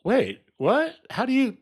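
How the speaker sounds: high, laughing disbelief; imitating astonished neighbor. Neighbor asking how he knew, high laughing surprise. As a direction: play the neighbor floored and laughing. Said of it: "and he's like".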